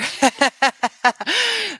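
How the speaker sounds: sudden high giggle; high loud laugh. Real, not acted. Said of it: "are we past twenty-four minutes".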